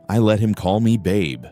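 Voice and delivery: plain reading voice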